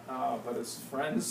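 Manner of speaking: ordinary delivery, nothing marked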